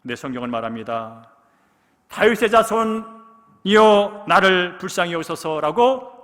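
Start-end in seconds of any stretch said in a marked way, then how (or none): 2.1-6.2 very slow, loud, high pleading shout; louder cry. Retold or imitated. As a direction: stretch every word to carry. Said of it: "더 크게 소리를 쳤답니다".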